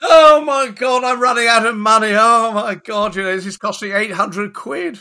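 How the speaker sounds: loud, whiny mock panic; exaggerated whining about cost; mock moaning. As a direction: ham up his money complaints, loud. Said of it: "you kept on moaning about how much it was costing you".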